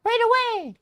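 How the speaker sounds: very high, loud chirp. Acted. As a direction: answer in a squeaky high lady voice. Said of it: "I'm gonna pull a higher pitched voice for this lady".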